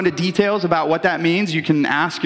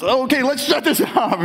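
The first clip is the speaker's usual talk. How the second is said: high-pitched, hasty mock alarm; flustered parent; 8 semitones higher than usual. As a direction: hurriedly call it off in comic panic. Embarrassed parent hastily stops the film.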